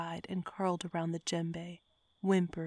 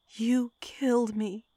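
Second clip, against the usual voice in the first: accusing; 5 semitones higher, 6 dB louder than usual. A narrator's reading. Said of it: "Mary accused".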